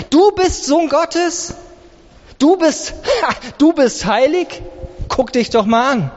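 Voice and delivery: high, mocking incredulous questions; scornful doubting. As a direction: cast doubt with a rising, sneering lilt. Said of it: "alles Mögliche ruft und sagt". (spöttisch anzweifelnd)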